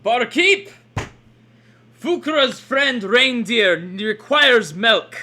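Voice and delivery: loud, slow creature call; booming creature voice. Acted. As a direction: call out to the barkeep slowly. Creature character orders milk loudly.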